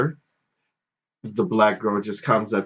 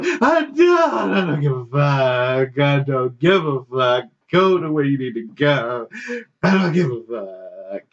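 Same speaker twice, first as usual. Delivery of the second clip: dismissive retort; 3 semitones higher than usual. Retold or imitated.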